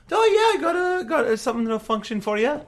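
high, loud shopkeeper patter. Acted. Shopkeeper looking under the counter for a customer, high character voice. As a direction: offer it in a high, put-on merchant voice. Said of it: "He's like".

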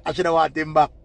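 ordinary talk, nothing marked

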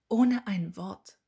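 loud, drawn-out cutting remark; can't-resist jab, loud and deliberate; pointed interjection. A narrator's reading. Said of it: "Sie konnte sich das nicht verkneifen".

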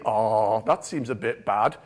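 low-pitched kindly sympathy. Acted. People react to news from the mother country, kindly and low. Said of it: "like, kindly".

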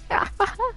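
short, high-pitched laugh. Real, not acted. Streamer laughing after hearing own voice, high laugh. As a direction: burst out in a high giggle.

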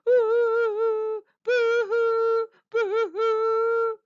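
loud, very high storybook boo-hoos; hungry mock wailing. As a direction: wail pretend sobs at a high pitch. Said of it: "poor Amelia was so hungry".

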